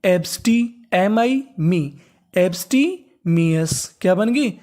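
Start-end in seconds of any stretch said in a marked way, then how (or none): 2.3-4.0 slow syllable-by-syllable. Shown as a demonstration.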